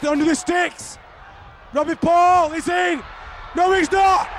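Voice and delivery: ordinary delivery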